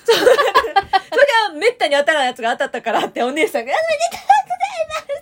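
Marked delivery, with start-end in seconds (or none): 3.7-5.2 very high, fast, laughing shout of congratulations; shouted mock congratulations. Clerk yelling congratulations at the rare winner, imitated. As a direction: blast out the cheer like an overexcited clerk. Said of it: "って言われるの".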